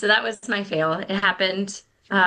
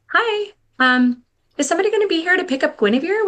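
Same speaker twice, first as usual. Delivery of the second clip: higher, louder, fast chirpy office voice; school office caller; 11 semitones higher, 5 dB louder than usual. Retold or imitated. School office calls the mom about pickup, chirpy and quick. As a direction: play the brisk school receptionist phoning. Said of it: "get a call from the school".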